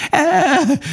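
high, piercing wail of pain; a sudden high wail; wailing cry. Acted. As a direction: cry out like a hurt hare. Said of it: "jajgatva sírt".

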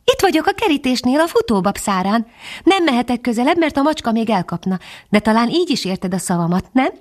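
a small titmouse calling from the bean stalk; tiny high bird voice; high little bird voice. A narrator's reading. Bird character speaks.

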